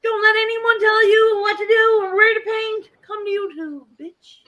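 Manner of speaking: high, loud rallying cry, easing off at the end; high-pitched mimicked pep talk; imitated hype shout. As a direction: holler it like an excited promo. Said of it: "And M J said".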